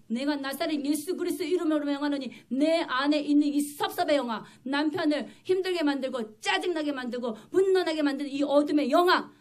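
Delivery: loud, forceful rebuke of a spirit; commanding exorcism prayer. Retold or imitated. Preacher re-enacts casting out the spirit of resentment, loud command. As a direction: drive it out with full-voiced authority.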